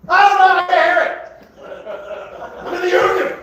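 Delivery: high, loud oblivious yell; headphone shouting. Acted. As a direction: shout over music only you can hear. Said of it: "their parents were just had these headphones on".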